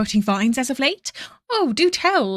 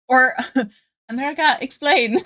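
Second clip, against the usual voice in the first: ordinary delivery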